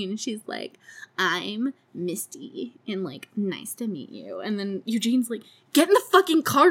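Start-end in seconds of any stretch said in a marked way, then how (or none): 1.2-4.4 slow, softer coy introduction; mock model drawing out her name; posing like a model. Girl posing for photos introduces herself, mock vamping. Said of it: "like, model pitching, like, voguing". 5.7-6.7 higher, louder snapped order; retold yell to hurry someone along; mock exasperated order. Eugene orders Misty into the car, mock exasperated yell.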